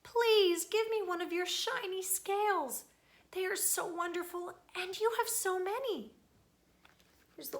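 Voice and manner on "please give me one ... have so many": high, eager pleading small-fish voice; little blue fish begging Rainbow Fish for a scale. Acted. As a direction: beg sweetly for a shiny scale.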